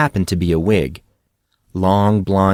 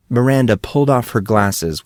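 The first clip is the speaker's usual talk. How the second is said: ordinary delivery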